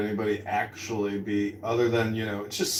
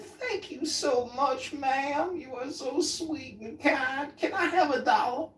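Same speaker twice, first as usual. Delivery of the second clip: sweet grateful voice; slower than usual. Retold or imitated. Man sweetly thanking the woman.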